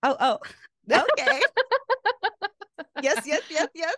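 mock gasp of discovery then laughing yes; dramatic reenactment. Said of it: "I love the dramatic reenactment".

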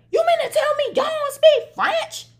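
mock the man's shocked question way up high; incredulous stranger. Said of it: "He said".

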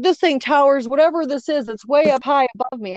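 excited mock fright in retelling; retold alarm. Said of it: "he was terrified".

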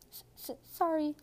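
a giant choking on tears; sobbing apology. A narrator's reading. Hagrid apologising to McGonagall while crying, stammered sob. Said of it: "sobbed Hagrid".

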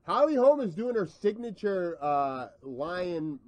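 a hint of ringside commentary; lightly voiced play-by-play; fight announcer.

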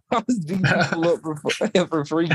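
can't get the joke out for laughing. Real, not acted. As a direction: break up laughing mid-sentence. Joking answer, laughing as he repeats it.

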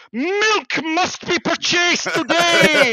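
put-on high, slow, grand old-fashioned declaration; stiff Victorian husband. Man handed a shopping list, answering in grand period voice. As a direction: proclaim it like a pompous Victorian gentleman. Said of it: "they go".

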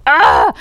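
high, loud frustrated "ah"; exasperated cry. Retold or imitated. Snubbed by the director at dinner, exasperated. Acts out silent outrage at dinner.

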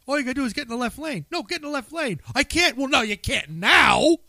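high-pitched exasperated car argument; mock angry bickering. Friend re-enacting the argument over the left lane, mad and exasperated. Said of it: "oh, you were so mad".

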